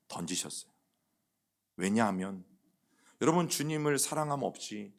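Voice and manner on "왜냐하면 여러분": ordinary delivery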